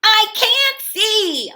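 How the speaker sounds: high, loud, slow wail; wailing snail. Acted. The snail wails in the gloomy dark, high and loud. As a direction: moan it out high and lost in the dark. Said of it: "said the snail".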